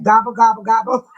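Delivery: low, punchy mock gobbling; playful turkey gobble. Host mocking their own turkey neck, playful gobbling.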